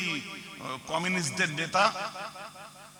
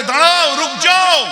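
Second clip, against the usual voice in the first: high, loud military command; forceful acted command; commander's order; 11 semitones higher, 13 dB louder, slower than usual. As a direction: shout it as an order to halt. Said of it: "order হয়েছে".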